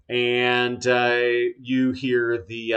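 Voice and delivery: ordinary talk, nothing marked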